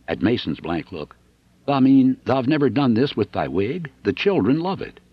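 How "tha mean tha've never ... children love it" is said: Dixon's northern voice. Acted. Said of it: "I hadn't done Punch's voice yet".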